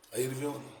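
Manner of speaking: ordinary talk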